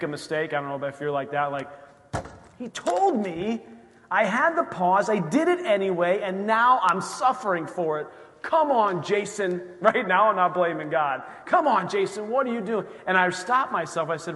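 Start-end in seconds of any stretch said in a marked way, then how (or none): 2.6-9.6 kicking himself. Retold or imitated. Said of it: "my reaction is I wanna kick myself". 11.4-12.9 kicking himself. Retold or imitated. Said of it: "my reaction is I wanna kick myself".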